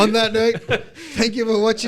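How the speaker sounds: loud, high sign-off; announcer voice. Acted. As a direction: wrap up the show like a host. Closing the video outro.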